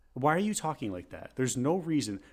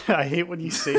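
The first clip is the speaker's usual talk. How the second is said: ordinary delivery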